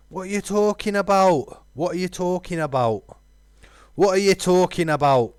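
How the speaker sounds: high, loud, repeated three times; emphatic repeated shout. Acted. Redoing the character line three times, loud emphatic shout. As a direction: hammer the question home each time. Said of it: "three times for emphasis".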